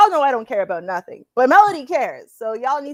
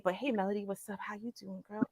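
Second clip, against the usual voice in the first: teasing, much softer come-on voice; mock soft greeting; 5 semitones lower, 16 dB quieter than usual. Flirting jokingly with co-host, much softer. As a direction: croon it low like a flirty hello.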